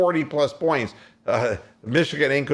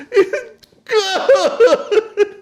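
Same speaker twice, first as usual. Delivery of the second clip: much higher, loud, half laughing; excited call; 9 dB louder than usual. Retold or imitated. Host replays the game-winner call, laughing and shrieking. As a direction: shriek it like the winning basket just dropped. Calling the winning shot, laughing.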